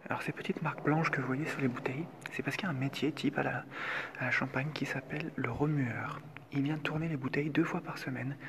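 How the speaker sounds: hushed tour guide whisper. Real, not acted. Whispering tour in champagne cellars.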